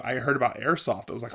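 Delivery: ordinary talk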